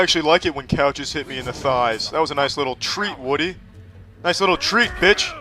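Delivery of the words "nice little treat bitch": higher and louder jeering taunt; mocking taunt. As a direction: rub it in, sing-song. Repeats taunt louder mid-fight.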